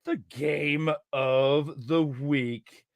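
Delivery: slow dramatic announcer build. Acted. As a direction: announce it like a big show. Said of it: "take it to".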